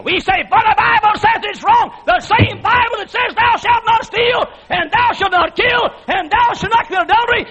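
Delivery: high, shouted sermon climax; preaching at full force. Acted. Preacher shouting scripture to the congregation, fiery. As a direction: shout it to the rafters like a revival preacher.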